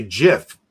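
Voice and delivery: "gif" with a soft g, like "jif"; soft-g pronunciation. Shown as a demonstration. Explaining what upsets people, "gif" with soft g. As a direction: start "gif" on a j sound. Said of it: "people get upset if you say".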